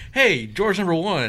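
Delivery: calling out. Pretend. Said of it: "at Christmas and go".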